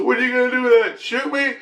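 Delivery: high, goading put-on voice; mocking dare. Man with shotgun in his face, mocking dare. Retelling a man's defiant dare.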